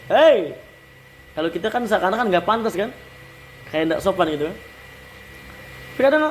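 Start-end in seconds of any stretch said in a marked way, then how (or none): 0.1-0.5 loud casual "hei"; calling hey. Shown as a demonstration. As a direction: shout hey to get someone's attention.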